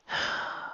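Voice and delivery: quiet unvoiced sigh; a sorry friend sighing softly. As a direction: let out a tired sigh. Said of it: "She let out a sight sigh".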